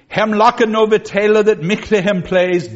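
put-on loud, rounded old vowels read aloud; Middle English reading. Shown as a demonstration. Teacher reading Chaucer's lines to the class in Middle English. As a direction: recite the old text like Chaucer. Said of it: "reading Middle English".

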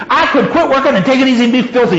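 high, rushed, mildly growled threat. A narrator's reading. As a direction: rattle off the defiant threat quickly. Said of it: "she growled".

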